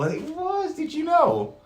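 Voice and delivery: very high, bright thrill; excited reveal. Acted. Storyteller reveals the dog to children, high and excited. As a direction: squeal the surprise to the kids.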